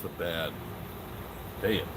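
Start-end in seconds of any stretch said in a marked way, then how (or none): none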